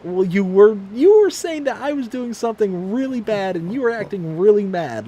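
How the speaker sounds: loud, high, defensive; husband justifying himself to his wife, loud and high; indignant defence. Retold or imitated. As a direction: raise your voice in your own defence.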